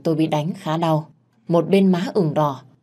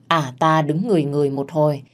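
ordinary delivery

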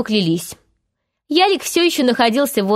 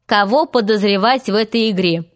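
plain reading voice

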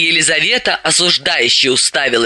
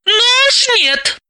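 much higher, slow indignant refusal; slower than usual. Acted. Dalila defends Galka, slow and indignant. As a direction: bristle at the insult to Galka. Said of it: "возмутилась Далила".

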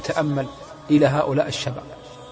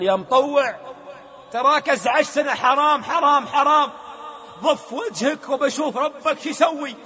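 mocking young man; 9 semitones higher, slower than usual. The young driver mocks the sheikh, sneering. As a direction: jeer at the preacher, slow at the end. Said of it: "والله قال بهذا اللفظ".

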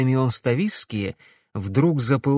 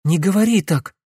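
loud, higher alarmed cry; exclaiming; 6 semitones higher, 4 dB louder than usual. A narrator's reading. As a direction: cry out in dismay. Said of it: "воскликнула Глория".

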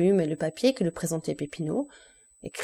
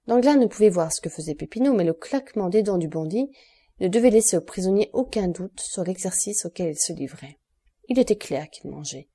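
ordinary delivery, nothing marked

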